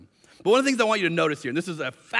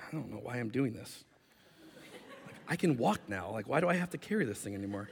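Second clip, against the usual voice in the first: low, quiet puzzled complaint; soft muttering to himself; reluctant grumble; 6 semitones lower, 12 dB quieter than usual. Acted. Healed man questions carrying his mat, reluctant grumble.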